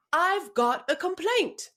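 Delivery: put-on slow, high English accent complaint; English accent, a customer barging in to complain. Customer barging into the office with an English accent. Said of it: "I'm gonna try it with an English accent".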